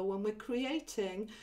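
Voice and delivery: normal talking voice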